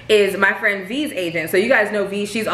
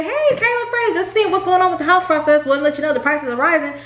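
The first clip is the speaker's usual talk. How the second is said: high, bright mock phone call from the realtor; lightly put-on sing-song realtor voice; mock cheery realtor; 6 semitones higher than usual. Wife mocking the realtor's missing update call, high and cheery. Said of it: "You didn't think to say".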